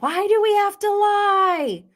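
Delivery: imitated yelling. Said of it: "Lisa's yelling".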